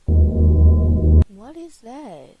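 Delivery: slow, low startled gasp of a question; stunned by the jump scare. Real, not acted.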